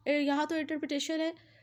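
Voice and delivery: ordinary delivery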